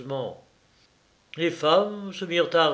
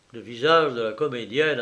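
ordinary delivery, nothing marked

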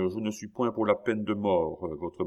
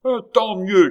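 much higher, much louder angry shout; 13 semitones higher, 11 dB louder than usual. Acted. King shouting down his minister, furious shout. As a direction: explode with rage. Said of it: "cria le roi".

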